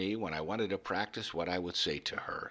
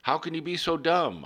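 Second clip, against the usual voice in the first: higher, louder mock shout; shouting at the tomatoes; 9 semitones higher, 4 dB louder than usual. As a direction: bark at the plants like a drill instructor. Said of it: "I'd shout at the tomatoes".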